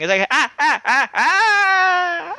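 high, rhythmic mock laugh; imitated laugh. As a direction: rattle off a staccato laugh. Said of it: "I laugh the exact same way as the Tim".

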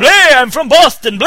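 put-on loud, slow, high Boston accent shout; mock Boston shout. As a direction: bellow it like a proud Bostonian. Joining mock Boston bit.

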